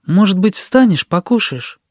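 high, tentative question; coaxing. A narrator's reading. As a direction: gently coax a child to get up. Aunt hesitantly coaxes boy to eat. (уговаривая, высоким голосом)

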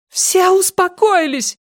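very high, stretched-out yell; frantic drawn-out command; shouting. A narrator's reading. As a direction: scream for order. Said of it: "кричала Силья".

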